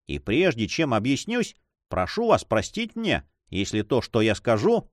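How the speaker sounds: ordinary delivery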